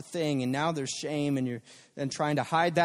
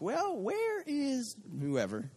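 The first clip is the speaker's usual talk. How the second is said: slow, higher mock-puzzled call, a bit softer; mock searching; 7 semitones higher, 5 dB quieter, much slower than usual. A parent playfully pretending to search for a child. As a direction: pretend you can't find the hiding child. Pretending not to see the kid.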